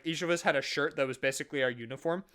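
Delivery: ordinary delivery, nothing marked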